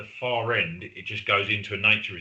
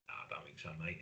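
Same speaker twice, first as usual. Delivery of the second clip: retold "nah" in a hushed whisper; mock whispered brush-off; 4 semitones lower, 17 dB quieter than usual. Replying to his mate's idea, a hushed dismissive whisper. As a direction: breathe the refusal out, almost no voice.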